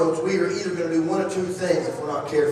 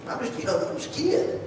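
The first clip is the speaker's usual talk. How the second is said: timid Jeremiah; 3 semitones higher, 7 dB quieter than usual. Retold or imitated. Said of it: "If you remember Jeremiah".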